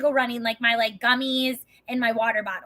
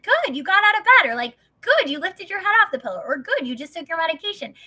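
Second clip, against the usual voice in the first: put-on much higher, bright praise like to a small child; patronizing praise; 6 semitones higher, 4 dB louder than usual. Husband praising his depressed wife like a child, much higher. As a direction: cheer her on like a toddler. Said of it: "He'd be like".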